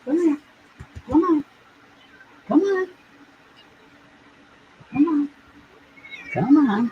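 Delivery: very high sing-song come here; repeated high kitty calling; high coaxing call. Acted. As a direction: coax the kitty over, high and sweet. Calling the cat.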